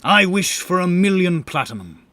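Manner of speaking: loud, high, eager wish; eager declaration. Acted. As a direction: blurt the wish out greedily. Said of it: "immediately declared".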